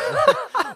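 a high giggling laugh; high burst of laughter. Real, not acted. Laughing at her own cheeky joke.

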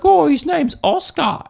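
a spider lover introducing their pet, high mock cooing; mock doting pet owner. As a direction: coo like someone naming a pet spider.

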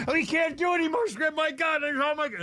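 high-pitched mock hysterical outburst; Larry David freaking out. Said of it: "he's freaking out and he's going crazy".

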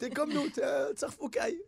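high, loud teasing punchline; mock taunt. Man ending his imagined comeback, high and taunting. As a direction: land the taunt with glee.

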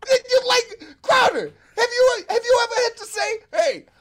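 high, exasperated laughing rant; incredulous comic outburst. Real, not acted. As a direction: squeal it in disbelief through laughter.

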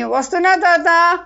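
loud, high shout back to grandpa; girl calls out while running home; calling back. A narrator's reading. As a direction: yell back, coming. Girl answers grandpa's call.

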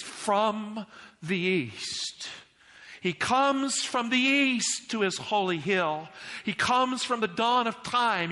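high, slow, rhythmic pulpit proclamation; impassioned preaching. Real, not acted. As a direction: preach it in rising, repeated waves. Preaches at raised force.

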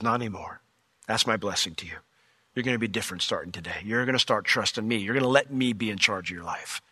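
low, soft voice of blessing; gentle reassurance. Retold or imitated. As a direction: speak softly and deeply, as God reassuring someone. Voices the Lord blessing Jacob.

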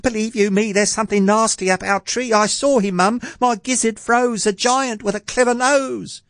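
very high, urgent lad's voice; alarmed boy gasping. A narrator's reading. Jack telling Mum about the giant, alarmed boy gasping. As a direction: blurt it out high and frightened. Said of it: "he gasped".

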